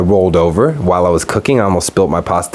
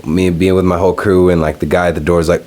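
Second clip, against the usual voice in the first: ordinary delivery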